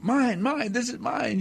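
put-on high whiny toddler voice; toddler saying mine. Imitating a toddler in the terrible twos, high and whiny. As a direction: grab like a two-year-old. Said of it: "the terrible twos".

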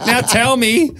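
high, demanding kidnapper; mock kidnapper demand.

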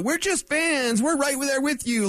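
mock fanboy, pitched well up; fanboy media role. Sports host mimicking fan-minded media, mock gushing. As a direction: play the fawning fan, high and drawn out. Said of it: "You can be the".

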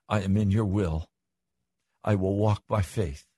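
hushed, unhurried surrender, coming down from preaching; childlike trusting prayer. Acted. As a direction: hand it over to God gently. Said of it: "like a child say".

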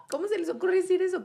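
high, fast outraged cry; how dare they, played up; indignant outburst. Indignant reply to registry's claim.

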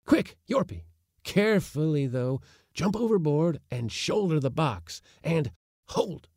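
put-on high, pressing old man; urgent old uncle voice. Uncle urging from the boat.

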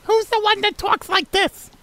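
very high, screechy sitcom-wife voice; hammed-up high-pitched character impression; Edith Bunker. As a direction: squeal it like a dotty TV housewife. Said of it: "That's Edith. Edith Bunker".

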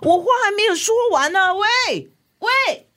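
high, fast indignant calling out; calling after her. Acted. Mother calls after her daughter as she escapes, rising calls.

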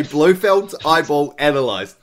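usual speaking voice

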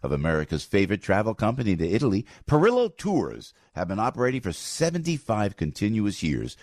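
upbeat radio ad read. Acted. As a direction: sell it like a host ad. Live host read of tour ad.

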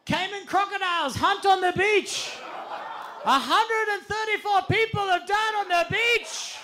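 put-on high, loud shouted warning in a Colombian accent; yelling Colombian accent. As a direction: call after them in a Colombian accent. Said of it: "Eduardo being like".

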